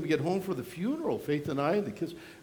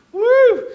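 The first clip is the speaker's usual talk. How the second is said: laughing whoop; 12 dB louder than usual. Real, not acted. Laughing at own impression.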